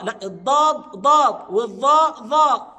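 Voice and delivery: loud, emphatic correction, slowed down; firm correction. Real, not acted. Teacher loudly warning students against the mistake. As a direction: set them straight loudly. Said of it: "بعض الناس ينطق الضاد ظا".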